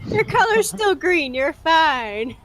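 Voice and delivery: mock reassuring. Reassuring dying ally in character.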